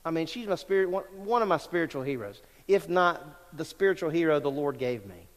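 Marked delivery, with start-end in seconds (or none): none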